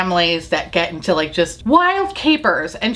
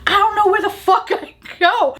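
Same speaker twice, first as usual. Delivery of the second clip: very high mock-frantic squawk; retold exasperated panic; 13 semitones higher than usual. Grandma told to return the scooter, mock-frantic outburst. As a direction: imitate a flustered grandma, pitched way up.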